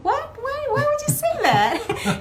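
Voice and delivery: mock outrage, half laughing; shocked. Retelling her shocked reply.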